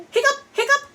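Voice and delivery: squeaky high mock hiccups; hiccup sounds. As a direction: hiccup twice, the second way up high. Acting out Pete's hiccups.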